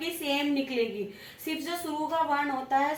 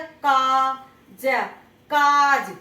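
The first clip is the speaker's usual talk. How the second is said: काज with का stretched very slowly and loudly; stress on का; 7 dB louder, much slower than usual. Shown as a demonstration. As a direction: hold the long का in काज. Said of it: "का कैसे बोला जाएगा".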